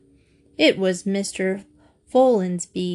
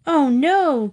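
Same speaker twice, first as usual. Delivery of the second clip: higher-pitched moan of dismay; 6 semitones higher, 4 dB louder than usual. A narrator's reading. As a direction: moan it as the plan collapses. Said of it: "Katy moaned".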